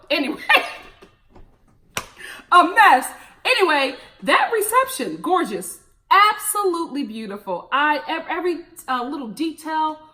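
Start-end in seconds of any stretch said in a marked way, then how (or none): none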